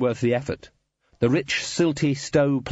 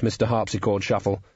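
usual speaking voice

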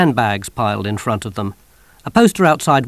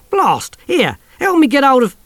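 high, loud startled cry; pained yelp; 7 dB louder than usual. Acted. Soldier scratched by the creature, yelping high. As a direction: yelp as the hand is clawed.

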